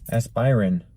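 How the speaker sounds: "aspirin" said as ass-pie-rin; spelling pronunciation. Shown as a demonstration. Host puzzling over spelling, "aspirin" said ass-pie-rin. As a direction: give "aspirin" a long I in the middle. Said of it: "isn't there another I in it".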